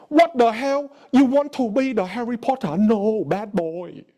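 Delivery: put-on voice of an outraged father; disapproving dad. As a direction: scold like an appalled dad, drawing out the last words. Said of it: "I just changed the way I sound".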